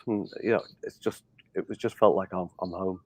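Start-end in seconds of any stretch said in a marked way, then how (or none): none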